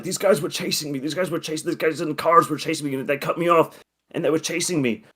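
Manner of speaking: mock out-of-breath panic; panting. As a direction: gabble it as if just back from a marathon. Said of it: "he's panting like, you know".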